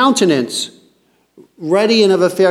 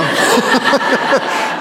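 laugh; 6 semitones higher than usual. Real, not acted. Amused by David's reply.

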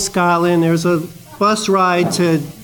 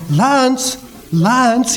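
imitated Scottish girl calling out, higher; higher Scottish call, trying to wake someone; Scottish accent; 6 semitones higher than usual. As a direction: repeat the name in a Scottish girl's voice. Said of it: "you know, like this".

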